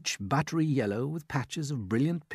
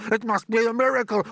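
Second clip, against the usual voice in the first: a much higher, louder put-on aunt's voice, excited; Aunt Sponge; 12 semitones higher, 7 dB louder, faster than usual. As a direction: play the greedy aunt gaping at the peach.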